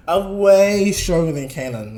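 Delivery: "way" stretched long, high and loud; stress on way. Real, not acted. Narrator comparing them to canon, "way" drawn out emphatically. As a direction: lean hard and slowly on "way".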